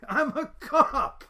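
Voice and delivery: high, loud, slow mock yell; imitated yelling cop. Cop identifying himself to a late student, mock yelling. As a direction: announce it as a hammed-up cop, laughing. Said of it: "he's yelling at me".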